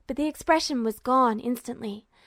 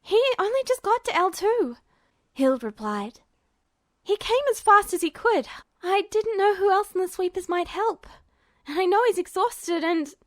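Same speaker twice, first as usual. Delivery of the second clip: put-on higher, hurried worried girl; defending her friend, pitched up; anxious young woman; 7 semitones higher than usual. A narrator's reading. Young woman explaining to Duo, higher and anxious. Hild explaining, voiced character.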